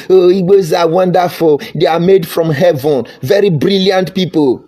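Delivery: high, gushing mock praise; hammed-up admiring singsong; mock flattery. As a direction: imitate an over-the-top flatterer. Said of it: "Anybody come and say".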